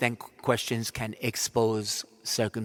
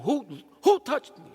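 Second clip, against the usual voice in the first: demanding call; 11 semitones higher, 5 dB louder than usual. Acted. Jesus demanding in crowd.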